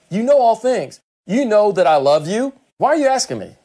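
mildly exasperated, rising high; half-annoyed, asking why he keeps asking; a little frustrated. Retold or imitated. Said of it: "This is kinda how I'd say it".

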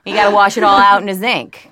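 laughing New Orleans yat accent. Shown as a demonstration. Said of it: "something very yat".

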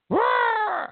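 high cackling laugh; high burst of laughter. Real, not acted. Host laughing at the media's flip-flop, high loud laugh.

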